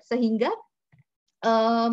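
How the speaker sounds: ordinary delivery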